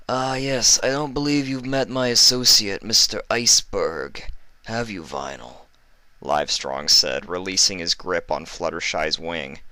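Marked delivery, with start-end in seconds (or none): 0.1-5.6 oily, higher mock-kind villain; sly doctor voice. Livestrong taunting the injured Fluttershy, oily and sweet. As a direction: coo falsely sweet like a sadistic doctor.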